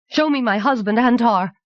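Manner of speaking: high, urgent order; commanding. A narrator's reading. Anagel orders her talisman to reveal her husband, commanding. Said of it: "she commanded it".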